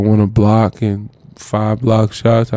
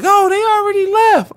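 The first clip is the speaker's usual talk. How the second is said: squeaky mock of the staff; high mocking voice. Staffer telling the rookie his bus left, high mock. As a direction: pitch it up like a teasing staffer. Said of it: "They like".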